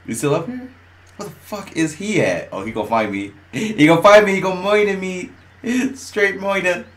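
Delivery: ordinary delivery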